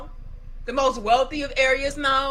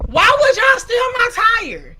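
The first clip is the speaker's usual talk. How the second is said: high, loud, near-crying yell at the thieves; half-sobbing shout of disbelief; retold outraged yell; 10 semitones higher, 6 dB louder than usual. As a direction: wail it out like you can't believe it. Outraged at stolen tires, retold.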